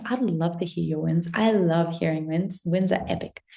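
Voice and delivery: normal talking voice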